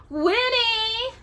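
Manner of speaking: high rising sing-song witch call. A narrator's reading. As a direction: call her name like a witch. Witch voice in story.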